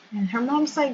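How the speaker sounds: usual speaking voice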